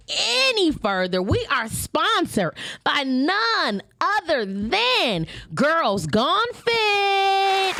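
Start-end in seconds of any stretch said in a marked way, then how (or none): 1.2-7.7 slow, drawn-out ad read; sponsor announcement. Acted.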